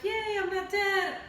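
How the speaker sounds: a hammed-up happy yay; mock joyful relief. Harry cured by phoenix tears.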